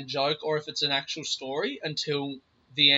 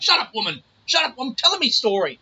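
mock snap; 7 semitones higher, 6 dB louder than usual. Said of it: "he's like".

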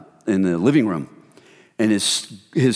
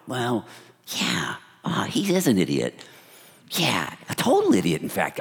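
gossipy hush; 3 semitones lower, 5 dB quieter than usual. Retold or imitated. Gossiping about Bob.

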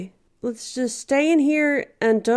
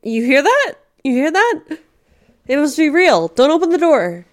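panicked; 4 semitones higher, 7 dB louder than usual. Acted. Hiding from animatronics, terrified.

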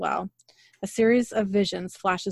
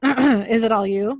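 voice cracking; 2 semitones higher, 7 dB louder than usual. Real, not acted. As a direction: voice cracks on the question. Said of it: "My voice just cracked".

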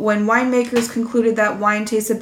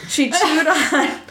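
laughing; 4 semitones higher, much slower than usual. Real, not acted. Laughing while reading gross part.

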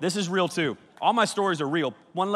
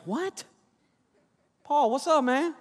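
high, surprised pop out of the verse; suddenly distracted; 10 semitones higher, slower than usual. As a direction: snap out of it like a distracted kid. Paul distracted mid-sermon, acted.

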